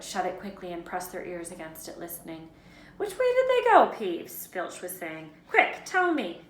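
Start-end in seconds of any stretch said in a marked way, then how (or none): 3.0-4.5 high, loud interrogation; demanding caretaker. A narrator's reading. Filch angrily questioning Peeves. 5.5-6.4 high, loud, impatient caretaker; insistent raised voice; urgent demand. A narrator's reading. Filch urgently questioning Peeves.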